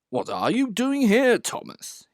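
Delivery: stern. A narrator's reading. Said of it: "he asked sternly".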